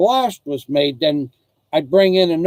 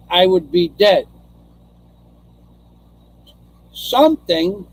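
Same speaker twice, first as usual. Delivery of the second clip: raised pitch, deliberate pauses between phrases; emphatic testimony; 5 semitones higher than usual. Real, not acted. Telling listeners why the near-death escape matters, emphatic testimony. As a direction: press each phrase home like a testimony, higher and insistent. Makes the point of the spirit world.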